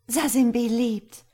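high, loud joyful outcry; joyful relief. A narrator's reading. Girl at the spring learning the boy lives, joyful outcry. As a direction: exclaim it with relief.

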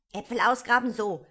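irritated outburst, hit hard; annoyed. A narrator's reading. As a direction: throw it back crossly. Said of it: "sagte das Kaninchen ärgerlich".

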